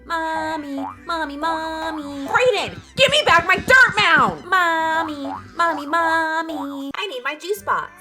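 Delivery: put-on high, drawn-out child's whine; whining child. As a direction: pester Mommy in a high kid voice. Voices whining children in parenting skit.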